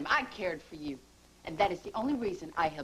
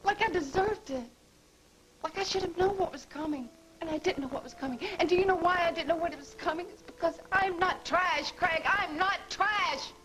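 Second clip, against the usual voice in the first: high, anguished protest; hurt outburst; 9 semitones higher than usual. Acted. Gloria to Craig after being tossed aside, anguished. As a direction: pour out the hurt.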